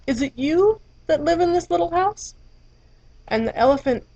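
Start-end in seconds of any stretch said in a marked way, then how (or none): 0.1-2.3 higher, light curious butterfly. Acted. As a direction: peep in and ask brightly. Butterfly peeps in asking.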